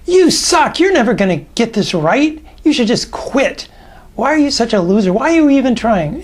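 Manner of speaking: mock the listener like a bullying inner critic; harsh critical inner voice. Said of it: "give me a sample".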